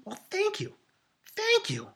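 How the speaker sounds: very high flattered squeak; mock flattered. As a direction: play the blushing flattered one. Reacting to a compliment.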